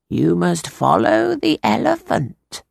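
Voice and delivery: drawn-out mysterious fortune-teller. A narrator's reading. Fortune-teller voiced in story.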